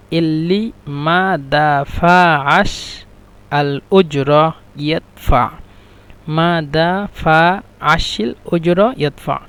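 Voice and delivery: ইল্লি মা দাফাস slowly, each syllable held apart; slow syllable demo. Shown as a demonstration. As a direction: dictate দাফাস piece by piece, da-fa-ash. Teacher breaks sentence into syllables.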